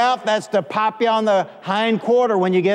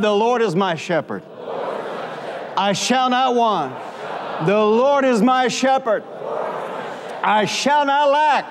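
out-loud declaration; 3 semitones higher than usual. Shown as a demonstration. Preacher has congregation repeat the psalm, out loud. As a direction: call it out for the congregation to echo. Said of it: "Say it out loud".

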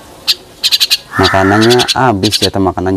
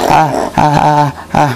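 pained yelps; 7 semitones higher than usual. Real, not acted. Bird biting his hand.

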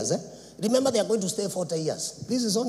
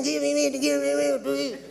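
whining crowd; 6 semitones higher than usual. Acted. People weeping for meat, mocked.

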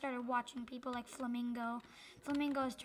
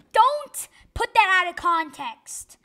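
slow loud protest; 5 semitones higher, 16 dB louder, slower than usual. Real, not acted. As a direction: say it loud and slow, defending yourself. Defensive after an odd remark.

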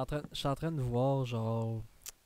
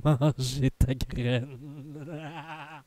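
laughing; 2 semitones higher, 8 dB louder, much slower than usual. Real, not acted. Laughing through a joke.

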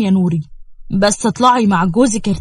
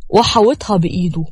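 ordinary delivery

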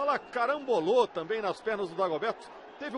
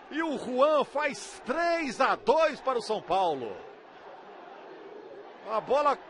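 usual speaking voice

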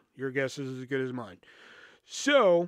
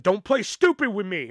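retold angry retort; 5 semitones higher, 5 dB louder than usual. Accusing mother's angry retort, retold.

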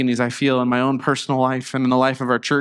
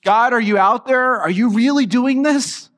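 higher, searching questions to God; wondering aloud, pitched up; questioning God; 7 semitones higher than usual. Acted. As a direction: call upward in urgent doubt. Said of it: "Caught up wondering".

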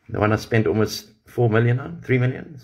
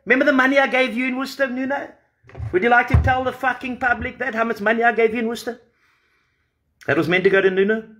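heated high-pitched accusation; 12 semitones higher, faster than usual. Real, not acted. Confronting Mornay about missing money.

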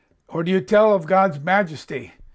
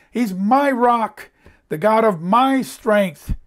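high, slow emphasis on "my"; stress on MY; 4 semitones higher, 4 dB louder, slower than usual. Shown as a demonstration. Preacher insisting it is personal, "my" stressed in "my rock". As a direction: hit "my" high and draw it out.

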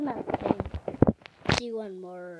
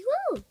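high woo cheer; 12 semitones higher, 4 dB louder than usual. Real, not acted. Little girl cheering her pony, high whoop.

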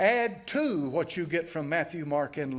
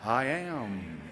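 slow, weighty I am with the stress on am; emphatic I am; 4 semitones lower than usual. Shown as a demonstration. Preacher gives the emphatic I am to his congregation. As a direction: let "I am." land low and solemn, a divine claim. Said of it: "how does he say it?".

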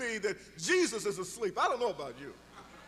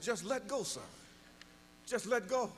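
calm voice of God; 2 semitones lower, 5 dB quieter than usual. Acted. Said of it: "And the voice said".

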